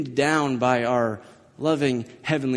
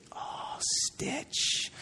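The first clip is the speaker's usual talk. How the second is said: whispered, much softer oh stitch; dread whisper; 12 dB quieter than usual. Retold or imitated. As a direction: breathe it in alarm. Boy hears the word stitch.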